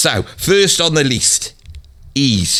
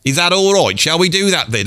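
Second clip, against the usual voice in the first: ordinary talk, nothing marked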